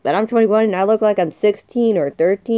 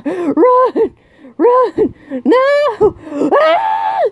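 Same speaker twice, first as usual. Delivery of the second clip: panicked screaming; 6 semitones higher, slower than usual. Real, not acted. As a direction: scream for safety in real panic. Fleeing Pennywise in panic.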